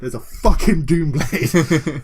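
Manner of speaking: loud, thrilled outburst breaking into laughter; real fanboy excitement. Real, not acted. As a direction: gush loudly, beside yourself with glee. Gushing over the new weapon.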